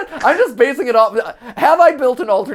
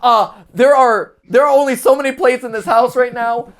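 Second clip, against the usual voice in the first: usual speaking voice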